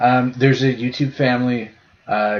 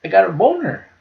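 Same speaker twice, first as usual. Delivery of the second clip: put-on higher toddler voice, calmer second time; little kid repeating; 8 semitones higher, 3 dB louder than usual. Retelling the kid's second answer, higher but calmer toddler voice. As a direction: repeat it matter-of-factly like a small kid. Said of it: "He's like".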